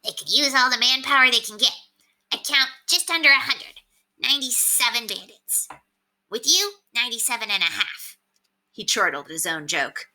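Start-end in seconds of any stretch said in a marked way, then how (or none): 0.0-8.2 higher, smug little rat voice; Septimus the rat. A narrator's reading. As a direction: play a cocky talking rat on a shoulder. Septimus joking.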